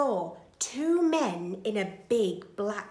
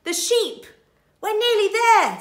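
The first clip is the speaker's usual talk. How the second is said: thief voice; 11 semitones higher, 11 dB louder than usual. Acted. Thief Len speaking.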